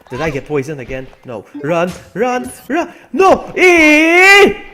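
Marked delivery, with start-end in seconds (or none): 1.6-4.6 frantic high yells; panicked shouting. Real, not acted. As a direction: scream in real panic. Chased in game, panicking.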